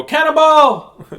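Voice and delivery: high, loud, drawn-out mock holler; hammed-up pool-jump yell; playful shout. Nancy jumping in the pool, playful shout.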